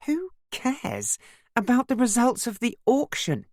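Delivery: high-pitched, exasperated outburst; snapping. A narrator's reading. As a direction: snap back in irritation, voice jumping up. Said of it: "I snapped".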